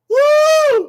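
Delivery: loud, very high falling whoop. Real, not acted. Performer breaking off the chant, whooping high and loud. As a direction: let out two piercing whoops.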